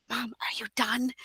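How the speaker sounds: whispered, imitating a small boy; hushed little son checking in; child's whisper. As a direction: whisper it like a kid trying not to interrupt. Son checking during mom's filming.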